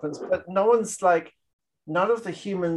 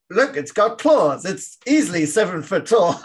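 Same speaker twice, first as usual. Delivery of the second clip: a hammed-up fifties soldier marvelling at the creature, half-laughing; excited B-movie discovery; 5 semitones higher, 5 dB louder than usual. Friend retelling a scene to his co-host, hammed-up awe.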